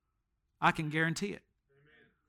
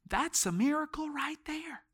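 whisper; 7 semitones higher, 7 dB quieter than usual. Real, not acted. Preacher marvels at a salvation.

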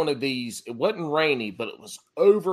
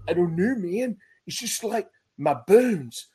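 higher, exaggerated Minnesota accent with long vowels; terrible Minnesota accent; 4 semitones higher than usual. Buddy whining in a mock Minnesota accent. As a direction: ham up a bad Minnesota accent, moaning about cold bones. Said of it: "this is gonna be a terrible Minnesota accent".

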